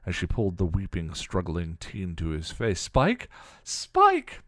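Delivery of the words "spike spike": high, shrieked cry for help; frightened girl's high-pitched shriek; screaming. A narrator's reading. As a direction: scream his name in terror. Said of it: "Dawn screamed".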